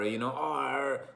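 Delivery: "R" slow, strong American R; R sounded. Shown as a demonstration. Teaching viewers to hear the R, slowly. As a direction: drag out a full rolled-in American "R". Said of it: "You wanna hear the R".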